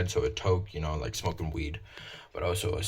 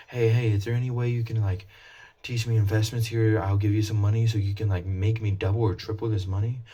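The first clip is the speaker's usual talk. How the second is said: mock eager voice, higher and louder; a hammed-up eager customer asking for investment tips; eager would-be investor; 4 semitones higher, 5 dB louder than usual. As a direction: beg someone to double your money.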